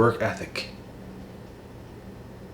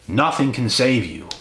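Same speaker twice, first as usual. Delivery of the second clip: normal talking voice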